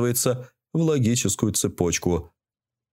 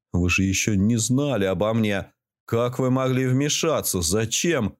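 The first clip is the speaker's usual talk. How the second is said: ordinary delivery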